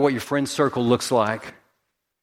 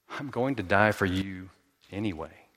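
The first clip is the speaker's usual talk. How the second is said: low, soft promise; hushed, steady assurance; tender resolve; 5 semitones lower, 6 dB quieter than usual. Acted. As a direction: say it gently, pausing before the last word. Jesus answers the angry sinner.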